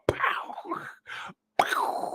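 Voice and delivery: whispered pow pow; quiet unvoiced gunshot noises; soft gunshot sounds. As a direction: breathe the shots like distant gunfire. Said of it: "All you hear in the background".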